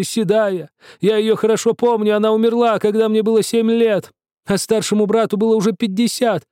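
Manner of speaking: put-on indignant old shoemaker. A narrator's reading. Yani berating Misha over the portrait, high indignant rant. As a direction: scold them like a cheated old man. Yani's long angry rant.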